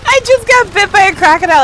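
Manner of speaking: high, loud outraged complaint; outraged shout. Real, not acted. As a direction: yell it, indignant. Outraged at being ignored.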